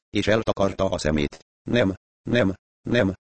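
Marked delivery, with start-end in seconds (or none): 1.6-3.1 whimpered. Acted. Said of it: "nyöszörgött".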